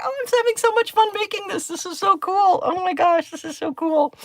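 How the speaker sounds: high, fast mock delight; sarcastic. Crafter mocking her own fun with sticky stickers, high sarcastic gush. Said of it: "for some free sarcasm".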